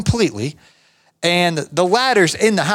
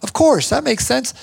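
much higher, easy-going yes; agreeable boss; 8 semitones higher than usual. Retold or imitated. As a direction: boss happily agreeing to pay fifty bucks, voiced much higher. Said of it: "He's like".

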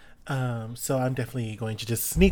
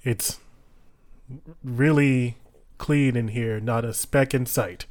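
incantation; 5 dB louder than usual. Acted. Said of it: "it requires me to say something".

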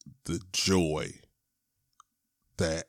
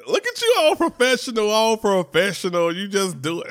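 much higher, loud mock-admiring aunt voice; an impressed relative ribbing him, higher and louder; teasing aunt; 13 semitones higher, 8 dB louder than usual. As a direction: tease him like a proud auntie.

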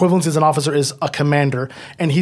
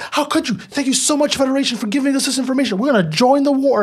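much higher, gushing mock voice; hammed-up credulous outrage turning to thanks; duped, grateful Romulans; 10 semitones higher than usual. Romulans thanking the Federation for the information, naively gushing.

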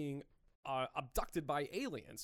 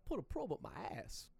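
Christopher Walken impression; 4 dB quieter than usual. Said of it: "I can't do a good Walken".

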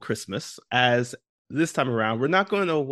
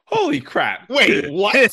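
high, loud disbelief; shocked; 9 semitones higher, 7 dB louder, slower than usual. Real, not acted. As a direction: caught totally off guard. Shocked at rubber band album.

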